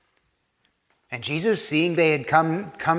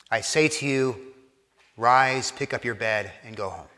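low, soft instruction fading away; quiet command; 6 semitones lower, 6 dB quieter than usual. Retold or imitated. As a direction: tell him gently, dropping lower and softer. Voicing Jesus healing the paralytic.

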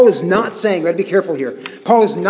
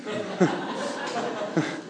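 a soft, unvoiced chuckle; quiet laugh; 13 dB quieter than usual. Real, not acted. Pastor laughing at his pre-kids self, a hushed chuckle. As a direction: laugh under your breath at yourself.

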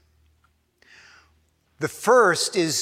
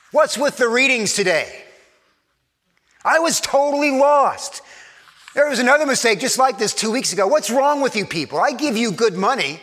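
much higher, louder, fast griping rant; indignant complaint; 7 semitones higher, 3 dB louder than usual. Shown as a demonstration. Parishioner complaining to the pastor about a misprint, loud and indignant. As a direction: scold the church like an offended customer. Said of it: "You hear the difference".

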